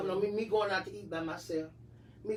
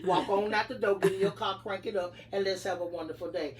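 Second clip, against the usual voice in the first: normal talking voice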